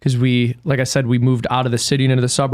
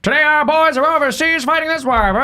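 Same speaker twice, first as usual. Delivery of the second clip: much higher, clipped transatlantic newsreel voice; mock wartime newsreel announcer, pitched way up; old newsreel announcer; 12 semitones higher than usual. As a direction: parody a 1940s newsreel announcer. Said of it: "hear the parodies of old newsreels".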